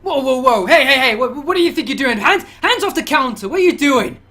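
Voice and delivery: high, loud officer yelling at the homeless man; officer shouting. Acted. Officer yelling at a man slamming the desk, loud and high. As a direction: bark him off the counter, loud and high. GM voices officer scolding Michael.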